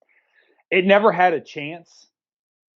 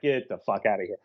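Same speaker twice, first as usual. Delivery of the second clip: low, softer growled dismissal; mock disgusted brush-off, pitched way down; Jack Palance; 9 semitones lower, 7 dB quieter, faster than usual. Actor dismisses the bad accent, low mock disgust. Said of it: "Jack Palance is like".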